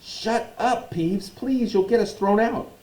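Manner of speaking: high-pitched anxious begging; urgent plea. Acted. Pleading with Peeves to hush.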